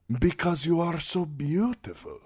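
sellsword boasting to the queen; flamboyant sellsword. A narrator's reading. Daario voice.

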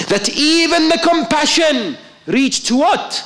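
high ringing preacher's call; preaching at full force. Acted. Preacher rousing the congregation, full force. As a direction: lift the hall with the question.

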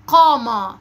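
loud, heavy q opening "قامة"; "قامة" modelled with a full, back q; emphatic initial qaf. Shown as a demonstration. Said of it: "هننطق كلمات تبدأ بصوت حرف القاف".

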